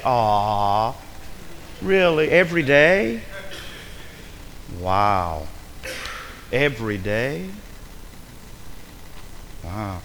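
drawn-out sarcastic sympathy, sinking low; mock amazement. Preacher mocking parent who worked every day, sarcastic amazement. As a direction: feign awe at a petty hardship, slowly.

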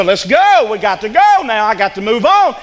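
all worked up, pushing to go; worked-up driver. Retold or imitated. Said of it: "you get all worked up".

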